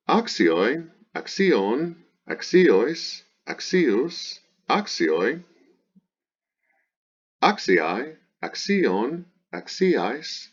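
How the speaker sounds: high singsong chant of word forms, each swinging up; paradigm recitation. Shown as a demonstration. Teacher reciting the declension, high singsong drill. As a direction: drill each form separately with an exaggerated pitch accent.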